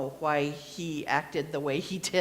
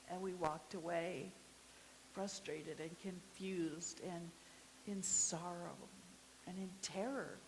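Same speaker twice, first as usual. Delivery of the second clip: hushed sorrow; 13 dB quieter than usual. Acted. Grief after the burial.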